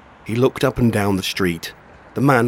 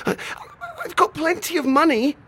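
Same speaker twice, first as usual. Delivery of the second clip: put-on boy voice in pain. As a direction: plead like a hurt teenage boy.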